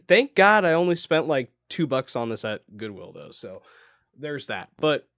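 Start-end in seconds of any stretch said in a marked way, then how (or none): none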